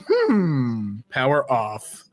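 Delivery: ordinary talk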